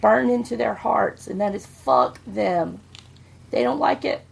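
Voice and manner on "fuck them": low, deliberate fuck them; defiant motto. Retold or imitated. Father passing his motto to his kids, low and defiant. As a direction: say it like a creed. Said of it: "two words that I've taught my kids".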